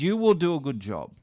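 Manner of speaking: "You will" pitched high, then falling; emphatic You will. Retold or imitated. Star reassures his young MC, emphatic "You will". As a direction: land firmly on "You will", retold assurance. Retold star turns praise on the speaker.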